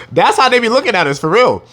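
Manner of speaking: can't keep a straight face; real amused laugh running through it. Real, not acted.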